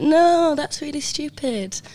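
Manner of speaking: high-pitched mocking refusal; mock dismissive. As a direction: mimic the group scoffing, high and drawn out. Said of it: "you all went".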